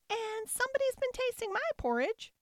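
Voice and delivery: tiny, very high squeal; squealing baby bear. A narrator's reading. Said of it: "squealed the baby bear".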